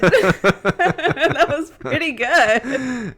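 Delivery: slow laughing praise; drawn out through laughter. Real, not acted.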